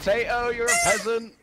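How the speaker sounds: high, loud mocking yell; shouted taunt. Real, not acted. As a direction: jeer at them at full volume. Shouted taunt mid battle.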